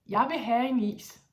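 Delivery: put-on a child flatly demanding ice cream. Shown as a demonstration. Teacher voicing a child demanding ice cream, blunt. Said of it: "That's not polite".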